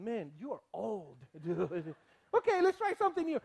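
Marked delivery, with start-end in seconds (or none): 0.0-1.9 mock listener jibe. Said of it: "some of you are saying".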